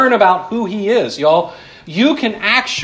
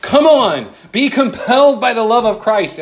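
a lightly mocking revival-style push; mock fiery preacher; 6 semitones higher than usual. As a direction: imitate a preacher urging the crowd on. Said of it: "I've heard preachers preach this passage like".

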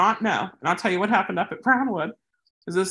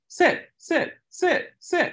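higher, repeated sing-song command; mock stranger commanding; 9 semitones higher, much slower than usual. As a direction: lightly imitate a pushy stranger. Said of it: "she's saying".

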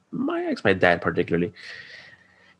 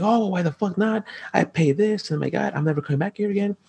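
high, fast whiny complaint; mock entitled customer; 13 semitones higher than usual. Customer whining at the bus driver, mock high and fast. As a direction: mimic a spoiled customer whining about rules.

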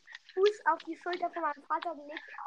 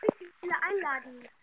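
usual speaking voice

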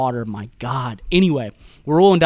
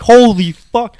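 loud, higher-pitched mock alarm; retold shocked exclamation; 7 semitones higher, 10 dB louder, much slower than usual. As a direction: burst out as if branches just hit you. Acted reaction to branches hitting them.

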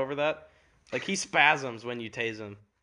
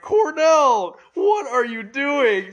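high, loud, drawn-out outraged shout; freaking-out yell; 11 semitones higher, 10 dB louder, much slower than usual. Retold or imitated. Student making a huge scene over a knocked water bottle, freaking out. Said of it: "Just freaking out".

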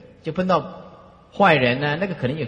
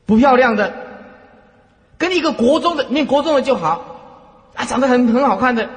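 amused teasing; 7 semitones higher, 6 dB louder than usual. Real, not acted. Teasing students with a choice.

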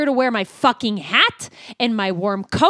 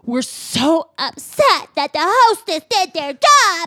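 put-on high, whiny spoiled-child voice; whiny baby voice; 7 semitones higher, 5 dB louder than usual. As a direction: whine like a spoiled kid. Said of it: "I really love when you do the baby voice".

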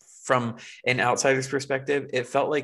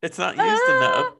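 laughing through the words, higher; 6 dB louder than usual. Real, not acted. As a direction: say it while cracking up.

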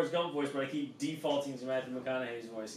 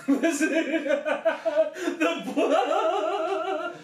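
laughing; 13 semitones higher, 8 dB louder, much slower than usual. Real, not acted. Laughing echo of quote.

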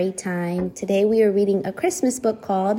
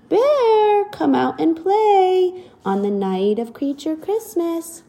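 neighing colt; 7 semitones higher than usual. Acted. Little colt calling the bear to play, high and bright. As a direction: call it out like a playful colt. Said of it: "they hear him neigh".

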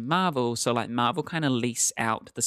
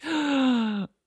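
dramatic gasp; 12 semitones higher than usual. Real, not acted. Sudden gasp, missing her shows.

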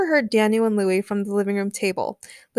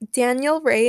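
ordinary delivery, nothing marked

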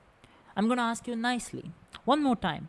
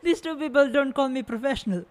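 scoffing through laughter, quick; laughing scornfully; 10 semitones higher than usual. Acted. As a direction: laugh off the insult. Mocking laugh at insult.

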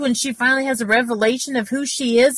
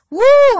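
loud high whoop of praise; a sudden, ecstatic preacher's whoop; joyful woo; 13 semitones higher, 9 dB louder than usual. Real, not acted. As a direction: shout it out in triumph. Exultant shout after rebuke.

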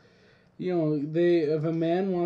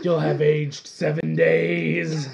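mock ominous; 9 dB louder, slower than usual. Mock ominous joke reply.

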